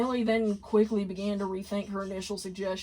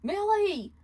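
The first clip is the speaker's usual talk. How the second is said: alarmed cry; 12 semitones higher than usual. Acted. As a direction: shout out in horror. Blitz cries out at injury.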